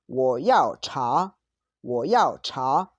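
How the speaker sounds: loud, very slow Mandarin with exaggerated tones; slow model pronunciation. Shown as a demonstration. Teacher showing students how to order tea, slow model pronunciation. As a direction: stretch out each word for learners.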